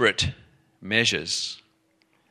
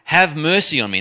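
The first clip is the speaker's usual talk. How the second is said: mock shout, higher and louder; retold shout; 6 semitones higher, 6 dB louder than usual. Woman shouting after Jesus for mercy, retold shout. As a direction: raise the voice in an imitated plea. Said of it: "She's shouting it".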